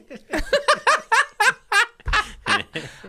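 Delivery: shrieking laugh way up high; cracking up at own pettiness; high squealing laugh. Real, not acted.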